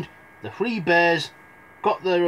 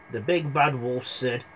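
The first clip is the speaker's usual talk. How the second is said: plain reading voice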